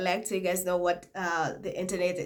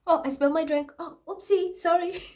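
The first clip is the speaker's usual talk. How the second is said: high, sing-song fake apology, much higher; mock innocent oopsie; 8 semitones higher than usual. To viewers, pretending to spill a drink on purpose, hammed-up innocent oopsie. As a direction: play the clumsy innocent, hammed-up.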